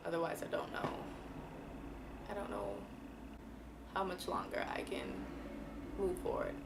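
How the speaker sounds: hushed, soft near-whisper of doubt. Real, not acted. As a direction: let the words sink to a breath. Despairing aside, voice drops.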